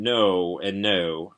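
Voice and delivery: ordinary delivery, nothing marked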